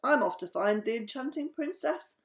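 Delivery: high, loud storybook declaration; excited cry. A narrator's reading. Max sets off to find the princess, crying out. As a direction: announce it as a hammed-up adventurer. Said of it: "cried Max".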